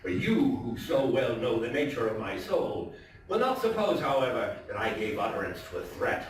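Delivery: dramatic, theatrical storytelling voice; story narration. A narrator's reading. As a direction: read it like a gothic tale told by the fireside. Dramatic reading of Poe story.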